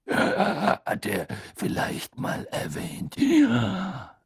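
slow raspy growl; drawn-out, indifferent rasp; Moody, unmoved. A narrator's reading. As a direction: croak it out lazily, rising on the last word. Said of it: "kratzte sich ungerührt am Kinn".

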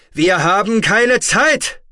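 high, very loud, drawn-out roar; thundering shout. A narrator's reading. Terran tells the hesitating Otoba time is up, thundering. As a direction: thunder it out slowly at full volume. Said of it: "donnerte der Terraner".

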